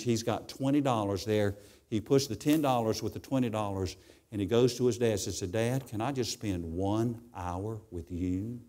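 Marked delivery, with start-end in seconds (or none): 5.4-8.6 put-on soft, slow child's plea; quiet plea. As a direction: hush it, slow and small. Boy's final plea to dad.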